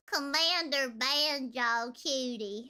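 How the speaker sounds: slow, drawn-out cutesy Southern drawl; hammed-up uwu Southern twang; uwu Southern accent. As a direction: play a cute Southern belle, stretching every vowel. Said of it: "Can you do both uwu Southern accent".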